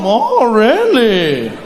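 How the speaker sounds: very slow, stretched mock surprise; sarcastic disbelief. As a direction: draw it out with knowing irony.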